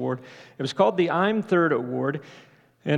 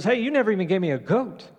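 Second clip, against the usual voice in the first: indignant mock complaint, pitched higher; mildly hammed-up outrage, higher voice; furious elder brother; 4 semitones higher than usual. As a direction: act out a son protesting unfair treatment. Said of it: "And he's furious".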